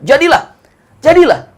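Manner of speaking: high, loud proclaiming cry; exclaiming. Real, not acted. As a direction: ring it out with awe. Preacher proclaims Kaaba's founding. (seruan penuh semangat, tinggi dan lantang)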